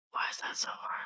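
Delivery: even fainter whisper, almost gone. Real, not acted. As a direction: let it fade into a whisper. Whispered again, even softer.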